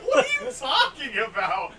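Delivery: loud, high, laughing feigned innocence over music; laughing shout. Retold or imitated. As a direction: yell through laughter pretending not to hear. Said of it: "I'm like".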